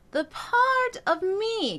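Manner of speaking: stretched out slowly in the high register; slow high speech. Shown as a demonstration. Coach showing speaking up high, slowly. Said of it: "Get comfortable with speaking up in there".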